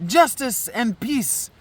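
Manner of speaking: put-on declaimed verse with a French accent. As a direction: carry the French accent through the prayer. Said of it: "okay, now I can't stop".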